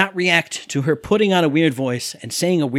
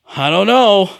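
louder imitated clueless reply; Fiona; 3 semitones higher, 7 dB louder, slower than usual. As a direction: mimic her throwing the answer out loudly. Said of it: "even Fiona has to go".

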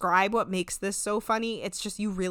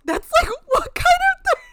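incredulous high squeal; 10 dB louder than usual. Retold or imitated. Incredulous reaction while watching.

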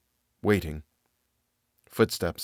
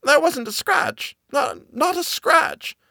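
very high and drawn out; awed, slow nanny; slower than usual. Acted. Nanny reveals to Miss Genevieve there was no wound, very high and slow. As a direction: let the horror sink in word by word.

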